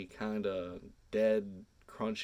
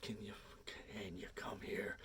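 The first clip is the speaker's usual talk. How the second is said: tense whisper, barely any voice; panicked hushed whisper to Katie; urgent whisper; 13 dB quieter than usual. Retold or imitated. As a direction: whisper it so the spider doesn't hear. Urging her away from the spider.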